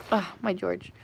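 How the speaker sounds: low, soft fond sigh of a phrase; doting murmur. Real, not acted. Owner doting on cat George, soft murmur. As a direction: murmur fondly to a pet.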